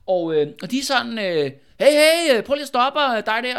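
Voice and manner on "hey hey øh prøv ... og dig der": higher, louder acted hail; calling out. Two noblemen stopping a man on a bridge, calling out. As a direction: hail someone to stop, pitched up.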